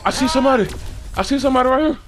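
loud, high, excited callouts; urgent callouts. Real, not acted. Player spotting someone outside for teammates, urgent callouts. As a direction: call it out urgently to your teammates.

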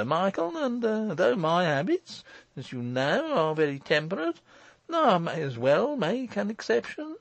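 high-pitched, halting false joviality; forced heartiness. A narrator's reading. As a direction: play the host with painfully put-on cheer. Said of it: "rather a painful affectation of heartiness".

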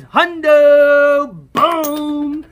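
loud, high triumphant holler; excited shout. Real, not acted. Player celebrates a hundred dollar winner, excited shout. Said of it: "Sorry for the excitement".

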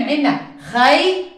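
ordinary delivery

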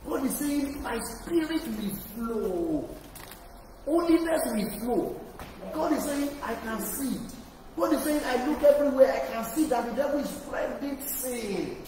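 loud, high, soaring sermon climax; full-force preaching. Acted. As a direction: shout God's agenda to the whole church.

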